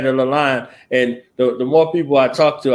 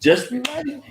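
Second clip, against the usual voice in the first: teasing warning; 13 semitones higher than usual. Real, not acted.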